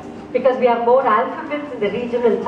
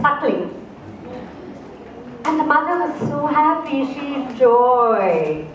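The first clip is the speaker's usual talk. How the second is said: storytelling narration. A narrator's reading. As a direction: draw the listeners into a fairy tale. Storyteller tells a cat fable.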